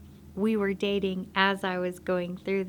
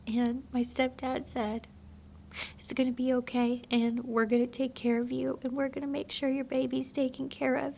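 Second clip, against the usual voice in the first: higher, emotion-choked testimony voice; tearful; 4 semitones higher than usual. Real, not acted. Telling how God showed her the past, tearful. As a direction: push through tears to finish the story.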